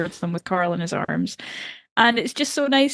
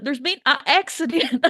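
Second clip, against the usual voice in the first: a hint of a put-on Southern accent, slightly higher; mock southern drawl; 6 semitones higher than usual. Podcaster mocks Maggie's southern line, light Southern accent. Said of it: "I did laugh when she's like".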